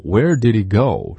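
Where did he go fast, h swallowed; 快读吞音; fast connected. Shown as a demonstration. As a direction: blur it fast so the h drops. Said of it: "所以当你说快的时候".

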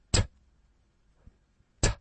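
unvoiced t, tongue tip flicks off the ridge; just the breathy t, no vowel; bare t sound. Shown as a demonstration. Teacher isolating a voiceless t for students. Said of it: "舌头舔上去".

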